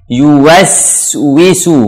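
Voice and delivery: "Yuwaswisu" slow, rounded YU and long S; full dhammah. Shown as a demonstration. Teacher demonstrating for learners, slow rounded "Yuwaswisu". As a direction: pout on YU and W in "Yuwaswisu". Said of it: "Dhammah sempurna pada yu".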